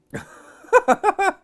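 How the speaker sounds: loud high laugh trailing down; long laugh. Real, not acted. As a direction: laugh hard and let it fall. Laughing at own joke.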